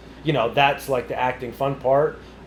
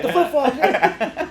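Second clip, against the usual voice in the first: his own reply; 13 semitones higher, 4 dB louder, much slower than usual. Retold or imitated. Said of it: "I'm like".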